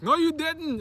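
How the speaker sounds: high, loud protest; mock indignant outcry; imitated student denial. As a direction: argue back like an insistent sixth grader. Acted argument, student denying.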